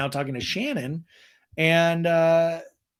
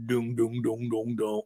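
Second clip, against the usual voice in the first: dramatic sting hum; 5 semitones lower, 5 dB quieter than usual. Retold or imitated. Finger goes up, dramatic sting.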